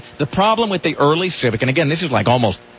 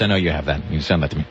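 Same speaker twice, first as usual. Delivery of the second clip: ordinary talk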